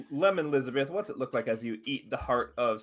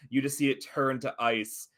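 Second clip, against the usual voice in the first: ordinary delivery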